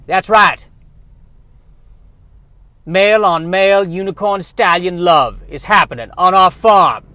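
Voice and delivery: a hammed-up country farmer shouting out the gossip; loud Southern farmer. Farmer loudly telling his wife about unicorn love, in a hammed-up Southern voice. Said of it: "has a southern bent".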